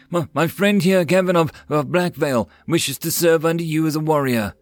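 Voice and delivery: a touch of nervous eagerness; nervous stammer. A narrator's reading. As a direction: stammer lightly while presenting a friend. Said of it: "knew how nervous and afraid".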